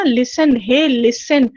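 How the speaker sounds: slow, insistent Listen, hey, listen; stressed call for attention. Shown as a demonstration. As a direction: hit listen hard and slow. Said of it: "अलग-अलग words पे stress देते हो".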